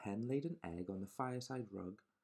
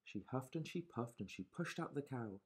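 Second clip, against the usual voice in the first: ordinary delivery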